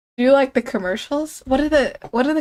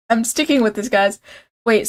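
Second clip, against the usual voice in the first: ordinary talk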